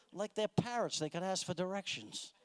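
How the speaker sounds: ordinary talk, nothing marked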